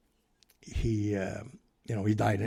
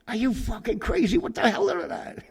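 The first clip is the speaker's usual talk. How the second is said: much higher, loud, panicked disbelief; shrieking in mock horror; hammed-up panicked yell; alarmed outburst; 14 semitones higher, 6 dB louder than usual. Gandolfini's alarmed outburst retold.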